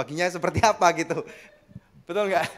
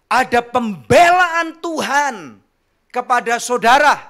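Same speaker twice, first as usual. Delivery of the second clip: slow proclamation; 4 semitones higher, 8 dB louder, slower than usual. Acted. Preacher proclaims God's defence.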